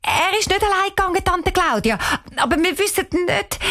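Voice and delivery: hastig und hoch, wie ein Mädchen; schnell gesagt. A narrator's reading. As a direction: rasch heraussprudeln, um abzulenken. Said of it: "Cheyenne sagt schnell". (eilig und hell gesprochen)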